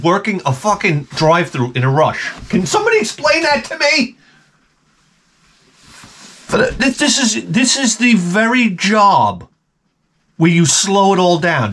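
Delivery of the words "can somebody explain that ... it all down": exasperated rant. Real, not acted.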